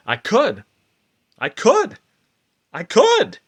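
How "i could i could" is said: leaps up high and louder, thrilled; high excited cry. Acted. Manic mind convincing herself, soaring and elated. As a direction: fling it up like a sudden revelation. Repeated "I could" building.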